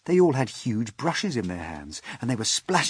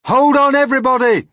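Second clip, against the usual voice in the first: much higher, loud call; a loud, high warning call; 13 dB louder than usual. Acted. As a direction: call out to warn everyone. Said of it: "James called out".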